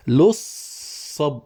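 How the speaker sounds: لصب stretched very slowly, the doubled ص held; very slow scansion of لصب; scanning syllables. Shown as a demonstration. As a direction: pull out لصب sound by sound. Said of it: "نصل اللام بالصاد ونكرر الصاد".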